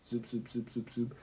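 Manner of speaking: soft quick zoop zoop sounds; zapping noises. Retold or imitated. Explaining the brain to viewers, soft zap noises. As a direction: imitate little neuron zaps. Imitating neuron zaps.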